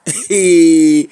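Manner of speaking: low, drawn-out laugh; low chuckle. Real, not acted. Speaker amused at own five-year-old remark, low laugh.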